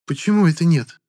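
high, pouty whiny question; capricious pout. A narrator's reading. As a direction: sulk like a spoiled girl. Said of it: "Капризно надула губки рыженькая". (капризно, надув губки)

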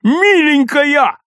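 high, drawn-out joyful yell; slow, high-pitched holler; yelling at full throat. A narrator's reading. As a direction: stretch each word into a loud cry. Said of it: "заорал во всё горло".

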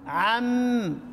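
عم ending on a held m; عم closed on the mim; stopping without ha. Shown as a demonstration. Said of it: "لو وقفنا عليها". (الوقف على عم بالميم)

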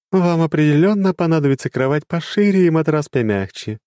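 high, mischievous advice; playful dark goddess; teasing goddess. Acted. Goddess teases.

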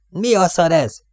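high, loud furious yell; roar. Acted. Jack yells as paper hits his face, high and loud. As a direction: blurt it out in blinded rage. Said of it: "üvöltött föl".

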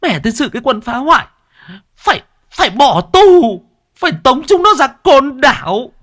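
very high, shrill-free loud ranting woman; angry wife demanding the vandals be jailed; furious wife. A narrator's reading. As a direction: rage about the vandals to the neighbour.

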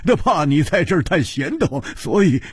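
chuckles breaking up the explanation; laughing. Acted. Hu Tiehua explains the trick to his friend, laughing. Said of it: "胡铁花大笑道".

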